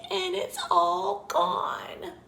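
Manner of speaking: high, slow little squeak; Baby Bear's squeak. A narrator's reading. Baby Bear finds his porridge gone, slow squeak. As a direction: squeak it out slowly, woeful cub. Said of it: "he squeaked".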